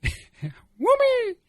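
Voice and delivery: very high-pitched squid squeal; squid sound. Shown as a demonstration. Showing friends weird mouth sounds, high squid squeal. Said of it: "the weird sounds I can make with my".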